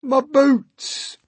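high, loud cry of dismay; centipede wailing. A narrator's reading. Centipede finds boots soaked, anguished cry. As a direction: wail over a precious loss. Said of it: "cried the centipede".

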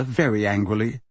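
ordinary delivery

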